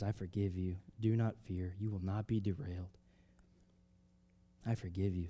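soft, low, tender reassurance; gentle forgiveness. Acted. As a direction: speak it softly like a brother comforting. Joseph voiced comforting his brothers.

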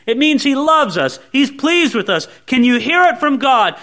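usual speaking voice

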